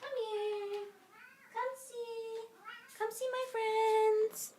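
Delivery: high, soft coaxing voice to a pet. Real, not acted. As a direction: coo to the cat. Baby talk calling the cat.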